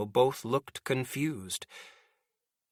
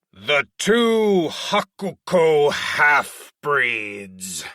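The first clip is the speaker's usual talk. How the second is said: slow, loud, deliberate roar; 5 dB louder, much slower than usual. Acted. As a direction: roar each word out slowly. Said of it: "he roared".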